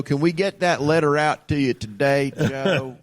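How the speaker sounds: a lightly voiced, too-smooth robocaller; robot telemarketer voice. Imitating the robot caller to his co-host, lightly scripted. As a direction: mimic a seamless scripted sales bot. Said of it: "This is the voice I'm listening to".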